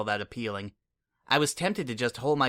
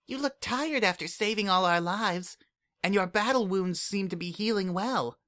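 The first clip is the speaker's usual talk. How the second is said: put-on much higher, earnest little changeling kid; Kukri's voice; 8 semitones higher than usual. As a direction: speak up like an eager, caring child.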